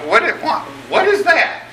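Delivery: sudden high squeak of disbelief; puzzled. Acted. Preacher asking his congregation, high and incredulous. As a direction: pitch it way up like you can't believe it.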